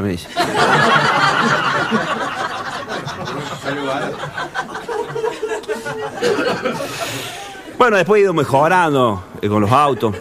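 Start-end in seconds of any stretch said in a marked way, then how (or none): 0.3-7.7 real laugh. Real, not acted. Laughs at own punchline.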